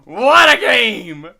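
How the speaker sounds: slow, loud exclamation; mock excitement. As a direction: stretch it out like a hyped announcer.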